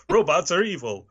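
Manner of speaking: high, stiff mock ad line; robotic SNL ad voice. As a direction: deliver it like a cheesy ad robot.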